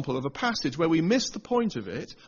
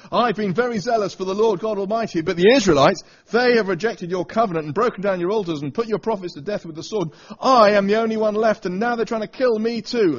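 Elijah's loud complaint; 5 semitones higher, 8 dB louder than usual. Acted. Preacher reading Elijah's complaint to God, loud and higher. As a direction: read it louder and higher, like a man at the end of his rope.

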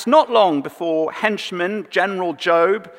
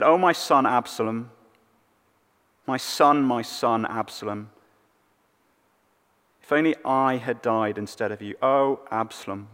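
fading softer and softer, a father's grief; grieving lament; 4 semitones lower, 3 dB quieter than usual. Retold or imitated. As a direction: let the lament sink to almost nothing. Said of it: "he wept".

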